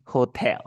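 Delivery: slow "hotel", leaning on the tel; stress on TEL. Shown as a demonstration. Teacher modelling hotel for student, stressed final syllable. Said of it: "La fuerza de voz es en tell".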